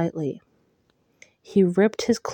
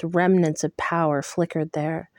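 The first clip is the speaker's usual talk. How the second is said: usual speaking voice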